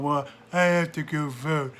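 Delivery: lightly whiny, slowed excuses; whiny complaint. Retold or imitated. Mocking complacent voters, a lightly whiny voice. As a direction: moan it like a put-upon voter. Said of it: "We whine and moan".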